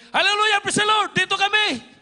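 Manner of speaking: hallelujah yelled high and loud; joyful shout. Retold or imitated. The hiding group shouts back to the soldiers, joyful loud cry. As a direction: cry out with relief and joy. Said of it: "Sumigaw na kami".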